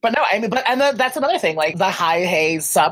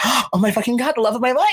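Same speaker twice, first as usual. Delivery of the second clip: gushing excitement; 7 semitones higher than usual. Retold or imitated. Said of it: "And I was like".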